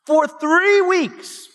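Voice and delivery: three weeks drawn out slow and high; a dramatic slow build on For three weeks; stress on three. Retold or imitated. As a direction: lean hard on three, slowly. Dramatizing the hidden detention.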